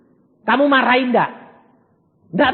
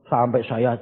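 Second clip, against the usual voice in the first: ordinary delivery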